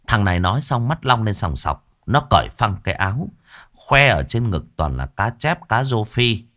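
ordinary delivery, nothing marked

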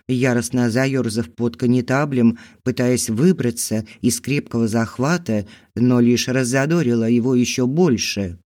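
usual speaking voice

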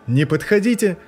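loud panicked shout; shouting from hiding, afraid; yelled warning. A narrator's reading. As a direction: scream at them to stay back. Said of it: "заорал вдруг кто-то".

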